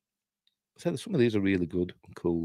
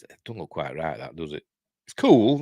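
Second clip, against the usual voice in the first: ordinary talk, nothing marked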